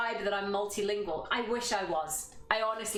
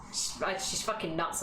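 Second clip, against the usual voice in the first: normal talking voice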